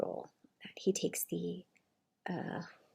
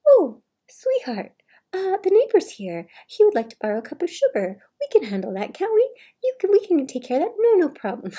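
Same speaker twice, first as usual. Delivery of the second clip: much higher, louder saccharine husband voice; fake sweetness; 10 semitones higher, 11 dB louder than usual. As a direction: pour on fake charm for the neighbor. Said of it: "he would look to my mom and say".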